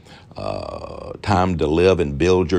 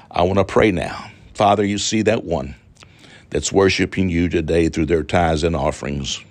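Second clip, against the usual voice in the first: ordinary talk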